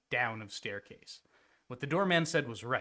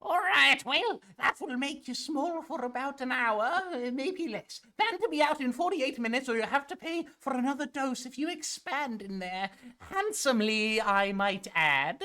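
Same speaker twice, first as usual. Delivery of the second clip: high, sweet shopkeeper voice; mud lady voice; 10 semitones higher than usual. Acted. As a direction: play a sugary old merchant.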